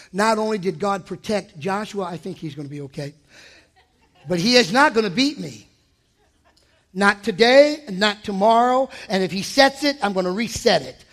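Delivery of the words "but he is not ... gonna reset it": usual speaking voice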